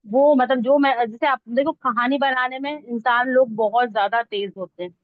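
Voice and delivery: normal talking voice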